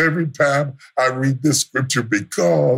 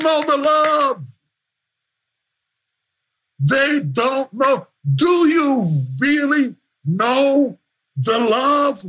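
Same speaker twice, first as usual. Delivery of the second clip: full-force preaching; 9 semitones higher than usual. Acted. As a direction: drive it home from the pulpit.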